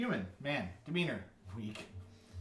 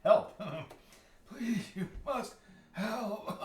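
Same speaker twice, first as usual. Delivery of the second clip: put-on high, strained old man pleading for help; desperate dying old man, breaking into laughter; 10 semitones higher, 3 dB louder, much slower than usual. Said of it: "my old man voice".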